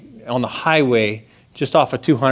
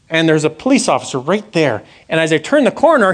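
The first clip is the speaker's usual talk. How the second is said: usual speaking voice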